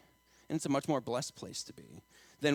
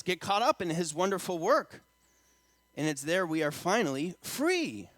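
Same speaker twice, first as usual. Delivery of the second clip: raised, driving sermon voice; impassioned preaching; 5 semitones higher, 6 dB louder than usual. Acted. Preacher urging congregation to surrender to God, impassioned preaching. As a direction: build to loud, high peaks from the pulpit.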